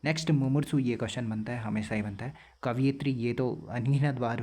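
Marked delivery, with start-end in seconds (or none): none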